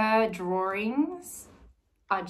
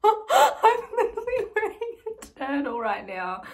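high, loud, laughing squeal; laughing delight; 12 semitones higher, 7 dB louder than usual. Real, not acted. Finds turtle gift matching hers, laughing squeal.

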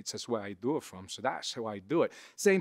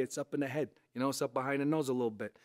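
ordinary talk

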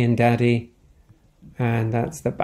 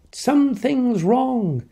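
worried cry; 8 semitones higher than usual. Acted. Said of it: "Then she cried out".

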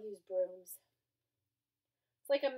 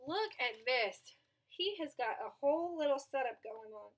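ordinary delivery

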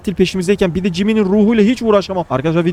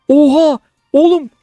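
high, loud jolt of shock; real shocked outcry; startled shout; 7 semitones higher, 5 dB louder than usual. Real, not acted. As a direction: yelp like something leapt out. Sudden scare in the dark house.

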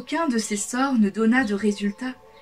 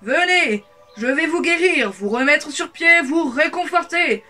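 loud, higher pleading shout; calling out; 6 semitones higher, 5 dB louder than usual. A narrator's reading. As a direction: shout the promise down the street. Wizard calls to villagers. (appel fort et aigu aux villageois)

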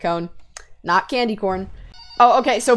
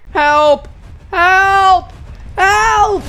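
loud, raised, repeated cries; calling for help; 6 semitones higher, 4 dB louder, much slower than usual. Real, not acted. Player calling to teammates for rescue, loud cries for help. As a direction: call out louder and higher each time.

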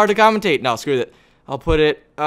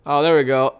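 usual speaking voice

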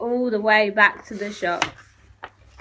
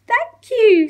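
high, louder goat voice; bright high goat thanks; grateful goat; 12 semitones higher, 7 dB louder than usual. Acted. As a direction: thank him like a relieved goat. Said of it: "said the goat".

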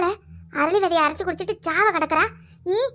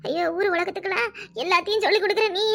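ordinary talk, nothing marked

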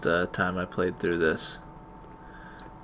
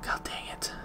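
frustrated whisper under breath; whispered curse; 12 dB quieter than usual. Real, not acted. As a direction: mutter it in a whisper. Quiet frustration at game.

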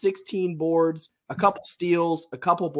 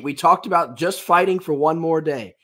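ordinary talk, nothing marked

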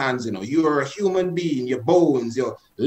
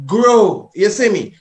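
forceful command; 5 semitones higher, 6 dB louder, slower than usual. Shown as a demonstration. Teacher showing listeners how to command the hand, forceful. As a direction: GROW called out high and loud. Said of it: "Put in your accent".

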